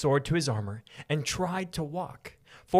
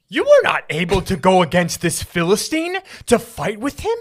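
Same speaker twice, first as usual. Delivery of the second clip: booming, pitched-up king; loud Saul; 4 semitones higher, 9 dB louder than usual. Acted. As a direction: boom the question out at David. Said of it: "He's so loud".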